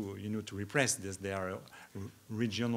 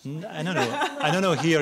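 words bubbling with laughter, louder; laughing while talking; 5 semitones higher, 10 dB louder than usual. Real, not acted. Still laughing at the joke while answering, louder. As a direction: answer through real laughter.

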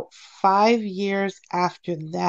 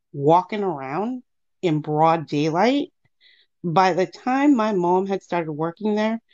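ordinary delivery

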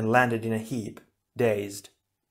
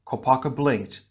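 usual speaking voice

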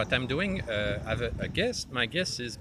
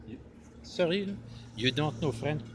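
usual speaking voice

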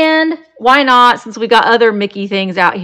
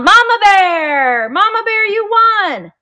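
calling out; 10 semitones higher than usual. Real, not acted. Calling mom who won auction.

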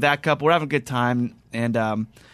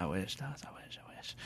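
breathy whispered aside; half-whispered I wish to himself; 4 semitones lower, 20 dB quieter than usual. Real, not acted. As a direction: mutter it under your breath, wishing. Wistful muttered aside.

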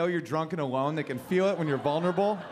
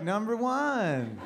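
slow deadpan; 3 semitones higher, much slower than usual. Retold or imitated. Deadpan reply to autograph seeker.

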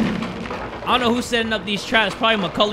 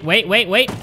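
ordinary delivery

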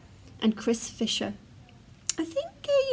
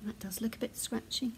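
plain reading voice

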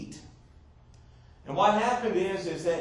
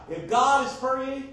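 loud, high southern-accented delivery; 7 semitones higher, 5 dB louder than usual. Shown as a demonstration. Preacher playfully quotes scripture to the congregation, southern accent. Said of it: "that's in the southern accent".

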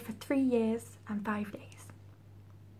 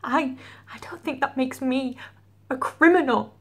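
tragic heroine sorrow. Acted. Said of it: "That's a feeling".

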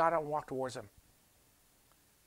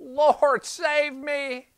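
a high, loud desperate cry for help; sinking man's desperate cry; 12 semitones higher, 8 dB louder, much slower than usual. Acted. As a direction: plead like a man going under the waves. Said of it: "Peter cried out".

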